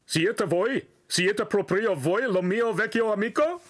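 high-pitched excited Italian hailing; excited shouting. A narrator's reading. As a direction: call out to a long-lost friend, thrilled. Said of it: "he shouted excitedly".

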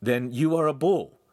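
mildly amused ribbing; smiling tease. A narrator's reading. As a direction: deliver a joke with a smile.

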